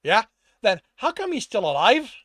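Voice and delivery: angry challenge. A narrator's reading. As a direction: challenge the excuse sharply. Boss challenges underling in quarrel.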